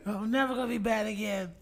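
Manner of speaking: put-on high, soft, rushed child's promise; contrite little daughter. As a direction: imitate a tearful kid promising fast. Imitating sorry crying daughter.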